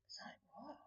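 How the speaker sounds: a near-silent, baffled whisper; disbelieving whisper. Real, not acted. To the camera, floored by the question: whispered disbelief. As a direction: breathe out "what?" in stunned disbelief.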